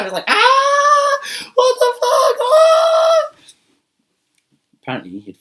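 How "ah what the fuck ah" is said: very high, loud shriek of panic; freaked-out scream. Acted. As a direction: scream as if you've seen a monster. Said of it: "the guy freaked out".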